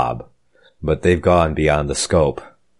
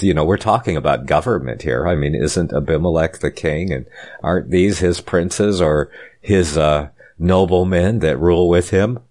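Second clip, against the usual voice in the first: normal talking voice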